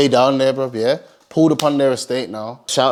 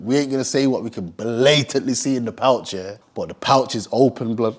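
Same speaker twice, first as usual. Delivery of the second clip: usual speaking voice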